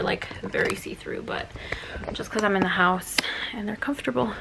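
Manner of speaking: hushed confiding whisper. Real, not acted. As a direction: whisper it like a secret. Confiding aside about the shorts.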